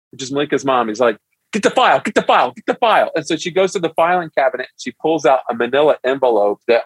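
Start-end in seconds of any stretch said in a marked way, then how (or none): 1.5-3.1 high, fast hurried command; eager order. Retold or imitated. Dad tells wife to fetch the dating file, eager. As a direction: rattle it off, keen to start.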